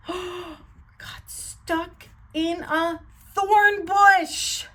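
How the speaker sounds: slow, rising gasped reveal; gasping dramatic. Acted. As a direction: gasp and build to the disaster. Dramatic climax of the story.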